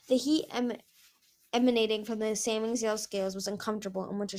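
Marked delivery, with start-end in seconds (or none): none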